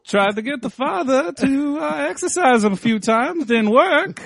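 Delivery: mock New England accent, recounting failures; Maine accent. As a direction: play along as a Maine sheriff. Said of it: "No, it's Maine".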